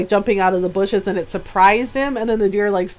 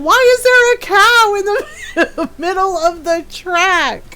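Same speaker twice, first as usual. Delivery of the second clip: higher, slower, laughing through it; 9 semitones higher, 4 dB louder, slower than usual. Retold or imitated. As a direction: ask it while cracking up. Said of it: "his friend is laughing, going".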